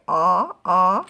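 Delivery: "ah, ah" as two separate loud short-o sounds; isolated vowel sound. Shown as a demonstration. Teacher leading into a new word, "ah, ah" sounded out loudly. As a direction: sound out "ah, ah" loudly, each alone. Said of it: "Gonna change the vowel".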